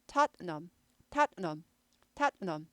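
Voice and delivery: tattenham with a glottal stop after tat; Tottenham demo. Shown as a demonstration. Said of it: "탓 하고 여기 스타카토 딱 있어요".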